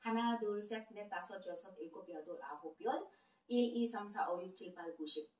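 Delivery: Korean numbers rattled off quickly and softly as a preview; quick sample run. Shown as a demonstration. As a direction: run through the count fast, just a taste. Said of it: "bigyan ko kayo ng sample".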